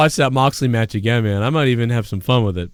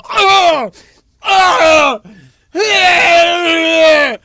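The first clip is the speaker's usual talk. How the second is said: very high, loud anguished screams; acted screaming; 7 dB louder, much slower than usual. Reacting to his buddy saying fired up, loud high screams. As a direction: howl in mock agony at the phrase. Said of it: "And I go".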